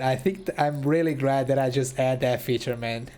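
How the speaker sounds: ordinary talk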